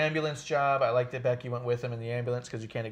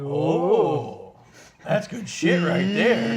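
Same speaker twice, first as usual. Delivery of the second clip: loud, high, drawn-out impression; mock-delighted boss, stretched and loud; Vince; 4 semitones higher, 9 dB louder, much slower than usual. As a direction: crow it slowly in a big put-on voice. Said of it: "and then Vince goes".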